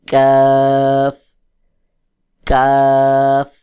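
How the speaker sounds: কাফ with a thin k and open long "aa"; thin light kaf. Shown as a demonstration. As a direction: say কাফ lightly, unrounded, vowel held. Teacher demonstrating the lighter letter.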